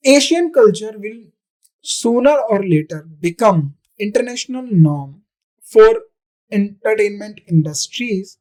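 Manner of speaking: each word read slowly and deliberately for the class; a teacher reading the question to students, slow dictation. Shown as a demonstration. Teacher reads exam sentence aloud.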